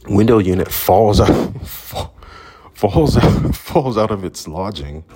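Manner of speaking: breaking up laughing mid-sentence; real laughter. Real, not acted. Telling how the window unit fell, laughing. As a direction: try to get it out through laughs.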